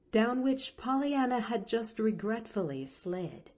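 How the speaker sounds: usual speaking voice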